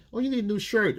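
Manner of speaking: buying him a shirt, mothering; doting older woman. Retold or imitated.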